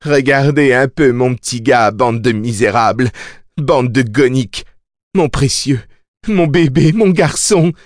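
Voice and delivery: high, demented proclamation, climbing as it goes; crazed yelling. A narrator's reading. Mia shows her baby to the assembly, yelling madly. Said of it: "leur hurla-t-elle, leur cracha-t-elle au visage".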